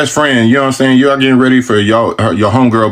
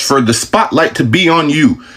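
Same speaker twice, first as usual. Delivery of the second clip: normal talking voice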